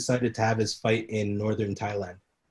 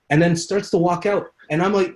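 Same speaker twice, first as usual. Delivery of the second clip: ordinary talk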